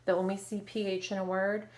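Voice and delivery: usual speaking voice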